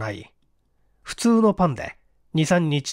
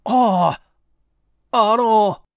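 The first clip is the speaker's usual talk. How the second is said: higher, louder flustered stammer; nervous halting stammer; 6 semitones higher, 5 dB louder, much slower than usual. Acted. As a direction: caught off guard and fumbling. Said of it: "どぎまぎしながら".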